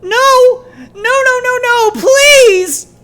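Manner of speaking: very high, very loud sobbing screams; anguished scream. Acted. Child screaming as father raises the rifle. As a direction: scream in utter horror.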